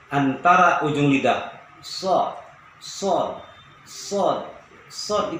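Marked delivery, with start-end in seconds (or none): 1.8-5.4 sod, sod with a hissing airy s; breathy sod. Shown as a demonstration. Teacher drilling sod so the air is heard. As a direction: let air hiss out on each sod. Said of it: "itu angin keluar".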